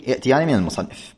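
ordinary talk

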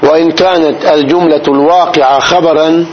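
normal talking voice

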